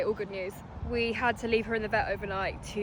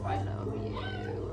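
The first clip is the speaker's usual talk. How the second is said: tender; 14 semitones lower, 10 dB quieter, much slower than usual. Real, not acted. Tender reunion with puppy.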